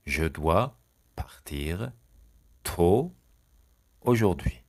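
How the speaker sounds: "Je dois", "partir", "tôt", "aujourd'hui" each set apart by pauses; chunked dictation. Shown as a demonstration. Teacher dictating to class, chunk by chunk. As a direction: break it into pieces for students. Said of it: "teacher splits into chunks".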